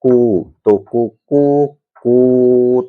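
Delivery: कु stretched longer each time; teacher demonstrating vowel length; lengthened vowel. Shown as a demonstration. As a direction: hold the u in कु, drawing it out slowly. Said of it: "अधिक और उच्चारण करेंगे अधिक समय तक".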